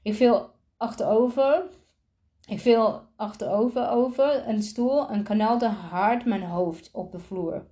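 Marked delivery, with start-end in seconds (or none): none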